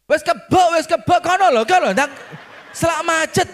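high, loud, hurried hollering; impatient attendant shout. Retold or imitated. Attendant hurrying a driver before traffic jams, shouting impatiently. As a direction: shoo the driver off urgently.

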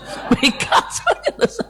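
high airy laugh; breathy laugh. Real, not acted. Preacher laughing at her own joke, airy laugh.

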